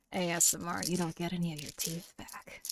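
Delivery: lightly creepy ASMR voice; mock ASMR. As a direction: tease in a hushed ASMR hush.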